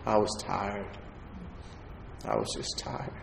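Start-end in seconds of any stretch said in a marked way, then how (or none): 2.2-3.1 breathy whispered repeat. Shown as a demonstration. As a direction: drop it to a bare whisper. Repeating the line, quieter the second time.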